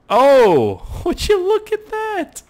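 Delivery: very high laughing squeak; squeaky surprised voice. Pretend. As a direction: squeal it in delight. High silly exclamation.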